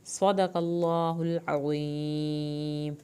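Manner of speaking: very slow chanted recitation with long held vowels; Quranic recitation. Shown as a demonstration. Teacher recites the verse for her students, slow and chanted. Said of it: "سیٹی کی آواز اور نون کی شد".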